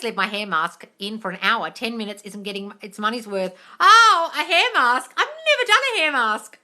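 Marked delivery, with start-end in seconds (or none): 3.8-6.6 high, loud startled exclamation; surprised. Real, not acted. Host caught off guard by a viewer's tip, loud and surprised. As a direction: gasp out the surprise. Reacting to a hair mask comment.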